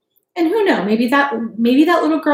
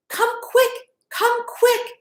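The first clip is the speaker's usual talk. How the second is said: put-on much higher, urgent little-girl call; eager calling; 13 semitones higher, slower than usual. Hannah calling people at the schoolhouse, high and eager. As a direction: hurry them along, breathless with news. Hannah calls through the town.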